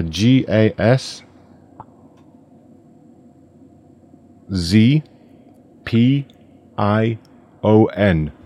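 slow, letter by letter; spelling it out. Shown as a demonstration. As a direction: spell each letter with pauses. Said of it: "How do you spell that".

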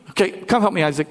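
ordinary delivery

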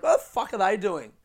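a touch of retold anger; indignant. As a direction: re-enact baffled irritation, pitch lifted. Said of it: "It made me angry".

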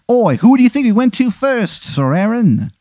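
high, indignant fake English retort; Henry the eighth, bad English accent. King retorts to Aaron, in a bad English accent. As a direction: protest in a hammy English accent. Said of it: "it's a very bad English accent".

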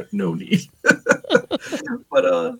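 no need said through a chuckle; laughing. Real, not acted. Husband laughing at the joke about his wife. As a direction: repeat it laughing.

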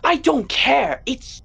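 high, loud, exasperated shouting; frantic, incredulous yell; furious outburst. Acted. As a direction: explode in disbelief, pitch leaping up. Acted quarrel, cutting off objection.

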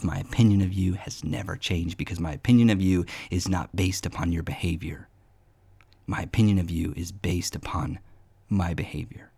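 low, soft reassurance; a hint of tender God. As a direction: speak as a gentle father. Voicing God to listener.

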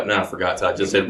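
usual speaking voice

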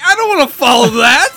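buddy quoted, laughing; laughing quote. Retold or imitated. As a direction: giggle out the buddy's reluctant remark. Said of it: "he's like".

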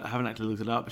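normal talking voice

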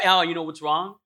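ordinary delivery

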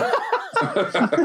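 a sudden high-pitched laugh. Real, not acted. Cohost laughs at his Simon Pegg joke, high laugh. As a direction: laugh at your own joke.

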